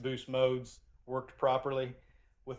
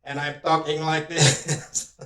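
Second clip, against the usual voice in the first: talking through a processed, robotic microphone; robotic mic sound; 6 dB louder, slower than usual. Real, not acted. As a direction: let the mic chop you into a robot. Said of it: "it kind of sounds like I'm a robot".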